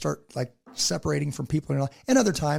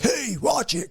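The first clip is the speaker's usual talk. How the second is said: mock challenge, higher pitched; confrontational; 7 semitones higher, 3 dB louder, much slower than usual. Parent showing how not to correct someone, confrontational. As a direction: call out a sharp warning, acted rather than real. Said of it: "'cause that's confrontational".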